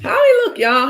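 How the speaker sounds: high, loud eager question; excited high question. Real, not acted. Asking chat about the Bahamian guy, excited. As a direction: perk up, eager to know.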